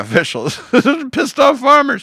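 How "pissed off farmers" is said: high, loud and slow through laughter; laughing. Acted. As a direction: laugh out the phrase. Laughing mock farmers.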